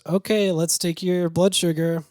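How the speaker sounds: high, slow, sweetly clinical nurse voice. Retold or imitated. ER nurse to Bob before his escape, high and slow.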